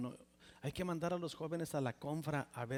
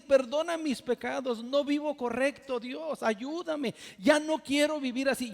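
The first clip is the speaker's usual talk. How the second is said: loud pleading prayer; 8 semitones higher, 8 dB louder than usual. Retold or imitated. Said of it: "Y yo no podía decir".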